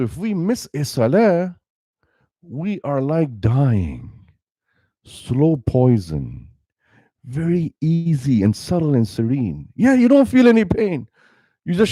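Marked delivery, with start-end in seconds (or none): 2.4-9.7 slow, heavy. Real, not acted. Preacher slows on dying image.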